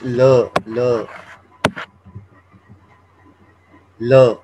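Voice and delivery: ल, tongue tip light on the ridge; Chinese l as la. Shown as a demonstration. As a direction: say ल as a clear l plus a. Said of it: "and एल को क्या करते हैं".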